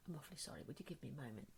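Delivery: soft and overly courteous; teacher politely excusing herself to a student; polite hush. Retold or imitated.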